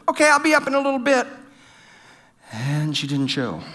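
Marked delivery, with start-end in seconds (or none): 0.1-1.3 wife's reply. Retold or imitated. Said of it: "She said".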